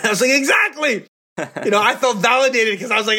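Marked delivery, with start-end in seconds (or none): 0.3-1.1 high, exclaimed vindication; emphatic agreement. Retold or imitated. Counselor feeling validated by the co-counselor, high emphatic agreement. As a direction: cry out in total agreement.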